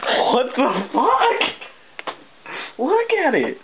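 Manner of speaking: very high, slow drawn-out what the fuck; pointing at it in disbelief; real shock, voice cracking high; stunned and thrilled. Real, not acted. Rare card shock again.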